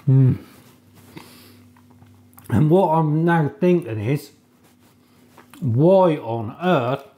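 chewing while talking; mouth full. Real, not acted. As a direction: between bites of food. Seen 1: holds tart, takes bites at 8.7-11.2, chews between phrases.

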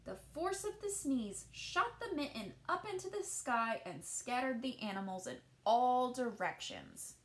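put-on gentle read-aloud voice for children; storybook narration. A narrator's reading. Teacher reading a picture book to children, gentle storytelling. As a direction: tell the tale as if to a class at storytime.

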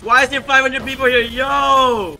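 high, loud burst of disbelief; startled. Real, not acted. Player stunned by five hundred people here, startled.

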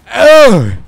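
loud, high startled "Äh"; shocked outburst. Real, not acted. Gamer reacting to a teammate's blunder with a startled "Äh". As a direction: blurt it out in shock. Outraged at a game event.

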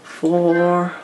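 high, drawn-out "Four"; mock golfer's call. Joking as they would say in golf, high drawn-out call. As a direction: call a long, high warning across the course. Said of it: "as they would say in golf".

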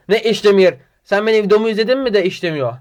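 real indignation at a rude comment; indignant retort. Real, not acted. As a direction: snap back at the commenter in disbelief.

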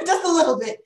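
high, amused laugh into the words. Real, not acted. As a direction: say it through a real laugh. Amused at the admitted sarcasm.

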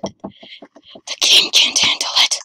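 slow, voiceless whisper; hushed, drawn-out whispered repeat. Real, not acted. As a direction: whisper it slowly in stunned awe. Same line repeated in whisper.

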